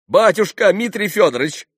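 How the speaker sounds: high, loud appeal; exclaimed. A narrator's reading. Landlord appeals to Mitya, exclaiming. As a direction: call out to him urgently. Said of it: "возгласил Трифон Борисыч".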